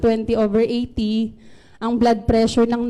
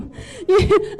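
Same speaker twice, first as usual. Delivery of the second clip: a real burst of laughter, higher; 7 semitones higher than usual. Real, not acted. Laughing at her own eleven out of ten pain joke. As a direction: let a high laugh escape.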